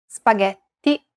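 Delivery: "Spaghetti" said slowly, spa-GHET-ti, open Italian vowels. Shown as a demonstration. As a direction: draw out "Spaghetti" syllable by syllable. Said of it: "How would you pronounce this word in Italian".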